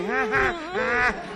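high-pitched cackling laugh; silly high giggle; laugh at own joke. Acted. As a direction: laugh alone at your own quip.

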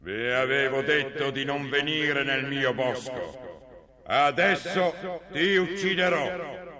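slow, booming troll threat. Acted. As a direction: drag out each word like a giant monster. (voce di troll lenta e minacciosa)

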